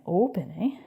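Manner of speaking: playful Canadian "open, eh?" with swooping pitch; Canadian accent. Shown as a demonstration. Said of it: "there's my Canadian accent again".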